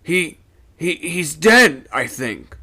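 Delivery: frightened mate reporting a death; in jerks. A narrator's reading. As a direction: stammer it out in shock. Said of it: "said the first mate in jerks".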